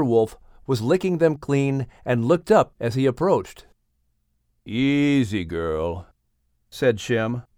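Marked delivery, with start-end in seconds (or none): 4.7-6.1 soothing. Acted. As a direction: settle the nervous wolf gently. Shem calms mother wolf.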